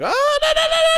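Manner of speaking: sudden shrill-pitched crazy outburst; very high mock freak-out; cray-cray. Host imitating JoJo going cray-cray, high crazed squeal. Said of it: "cray-cray all of a sudden".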